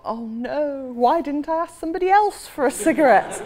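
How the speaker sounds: high mock wail of regret; comic dismay. Narrator regretting whom she asked, comically dismayed wail. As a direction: lament it, rising in pitch and volume. Acting out her own inner dismay.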